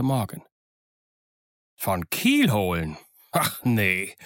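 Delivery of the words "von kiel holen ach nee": slow, sarcastic echo; drawn-out, unimpressed mock amazement. A narrator's reading. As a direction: repeat it back slowly with disdain. Silver sarcastically echoes Morgan.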